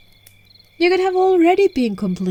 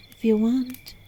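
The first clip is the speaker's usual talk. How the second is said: ordinary talk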